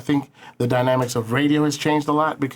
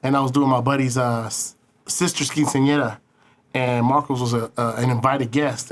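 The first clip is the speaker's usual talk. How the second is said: usual speaking voice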